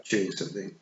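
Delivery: ordinary delivery, nothing marked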